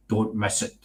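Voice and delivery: low, hushed, sinister teaser. Pretend. Host teases tomorrow's Amityville show, sinister voice. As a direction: drop it low and soft, mock-ominous. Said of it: "That was my sinister voice there".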